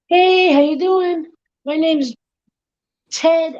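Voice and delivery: high, put-on small-talk voice; awkward party mingler. Party guest introduces himself to a stranger, small-talk mingling. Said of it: "one of them's trying to".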